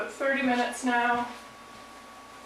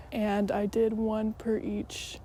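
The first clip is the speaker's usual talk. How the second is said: usual speaking voice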